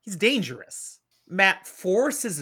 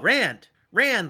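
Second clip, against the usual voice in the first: raised-voice hail to someone; acted call to get attention; 5 semitones higher, 5 dB louder than usual. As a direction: call the name out, louder and higher. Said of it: "he calls out".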